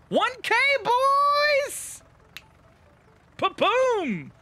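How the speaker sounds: high, slow stretched victory yell; ecstatic shout. Real, not acted. Streamer celebrating a thousand likes with chat, ecstatic yell. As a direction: scream it out at the top of your range.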